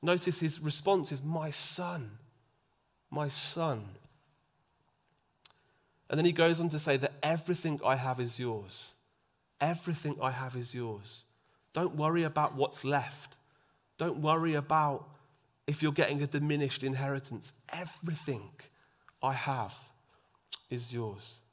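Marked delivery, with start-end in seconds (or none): 1.2-3.8 softer, tender call to a son; gentle father. Retold or imitated. Father gently answering his angry son. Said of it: "responds in love and in gentleness". 7.2-21.4 softer, tender call to a son; gentle father. Retold or imitated. Father gently answering his angry son. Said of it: "responds in love and in gentleness".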